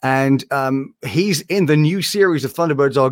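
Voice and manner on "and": ordinary talk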